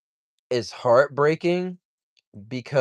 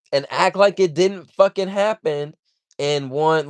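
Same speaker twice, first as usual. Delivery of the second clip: usual speaking voice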